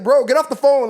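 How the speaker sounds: sharp loud bossy sister telling brother off; mock scold. Wife shooing brother off the phone, loud and bossy. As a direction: shoo him off the line, loud.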